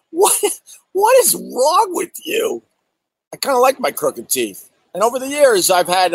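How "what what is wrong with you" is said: high, slow, incredulous what; mock disbelief. At the online critics, high and slowed in mock disbelief. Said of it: "I'm like".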